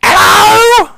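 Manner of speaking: put-on very high, loud cracked old gnome cry; old gnome looks up at the party and squawks a greeting; ancient gnome. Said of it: "As he looks up and goes".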